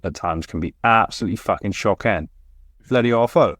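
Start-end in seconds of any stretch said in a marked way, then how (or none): 2.9-3.6 lightly put-on Welsh accent; a hint of a Welsh accent. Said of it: "What was that accent?".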